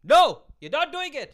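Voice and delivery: sudden loud high yell; shout. Shown as a demonstration. Man shouting at himself over a French fry, loud. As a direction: snap at yourself mid-reach. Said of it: "I should just be like".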